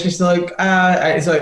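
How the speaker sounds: ordinary talk, nothing marked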